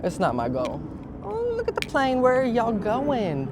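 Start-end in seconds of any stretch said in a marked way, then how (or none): 1.2-3.5 much higher, thrilled call to the sky; excited calling out. Real, not acted. Vlogger calls to a passing plane, excited and high.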